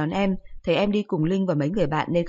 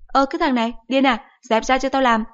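higher, louder irritated mom; scolding mother; 7 semitones higher, 4 dB louder than usual. Acted. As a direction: shoo the clingy son off. Mother pushes son away.

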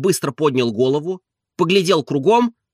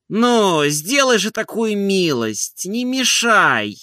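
high, slow, drawn-out plea; whining master begging persuasively; earnest pleading; 8 semitones higher, slower than usual. A narrator's reading. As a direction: coax the servant to let you sleep. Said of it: "убедительно говорил Обломов".

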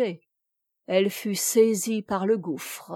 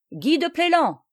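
high, ringing challenge; resounding call; 8 semitones higher, 4 dB louder than usual. A narrator's reading. Noël challenging his enemy across the water, resounding call. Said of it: "dit-il d'une voix retentissante".

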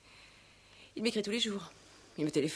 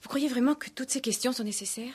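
higher, louder, indignant; irritated protest; 7 semitones higher, 6 dB louder than usual. Acted. Fiancée objecting to the commissaire's questions, indignant. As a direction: bristle at the questions.

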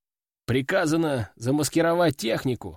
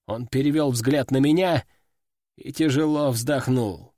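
normal talking voice

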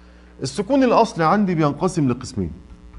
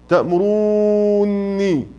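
تأمروني, slow, with a long level oo; long madd on waw; 3 semitones higher, much slower than usual. Shown as a demonstration. Teacher giving another waw example, very long oo in تأمروني. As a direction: hold the oo of تأمروني very long before the doubled nun. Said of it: "والواو".

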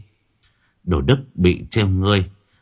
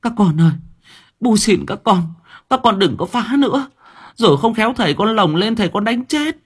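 mother crying out; 12 semitones higher than usual. Acted. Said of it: "Cô Mận phải gào lên".

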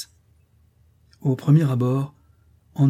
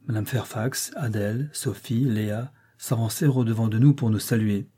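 ordinary delivery

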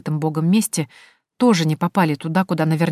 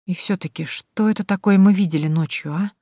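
ordinary delivery, nothing marked

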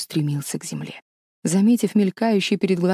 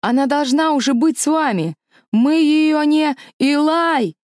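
alarmed shout; 9 semitones higher, 4 dB louder than usual. Acted. Aaron calls out in alarm.

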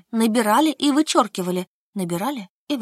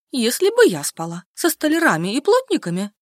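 с гордым вызовом, громче; гордо похвастаться подруге; 3 semitones higher, 4 dB louder than usual. A narrator's reading. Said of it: "гордо сказала Альжбетка". (гордо)

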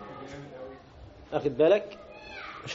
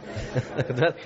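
short soft laugh; 5 semitones lower, 4 dB quieter than usual. Real, not acted. Laughs at the boxers remark.

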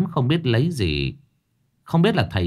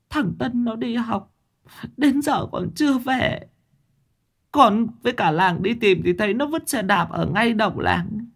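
tearful sobbing plea; 12 semitones higher than usual. A narrator's reading. A weeping mother begs the master to save her son. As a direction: beg through tears, voice pitched way up. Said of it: "bà Tám mếu máo khóc".

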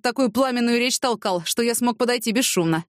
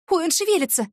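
sudden alarmed cry, voice jumps higher; startled exclamation; 10 semitones higher than usual. Acted. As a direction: gasp it out as she sees him move. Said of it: "воскликнула Яна". (испуганное восклицание, голос выше)

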